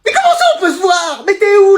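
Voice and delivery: high outraged parent shout; a parent yelling in disbelief. Acted. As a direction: explode at the nerve of it.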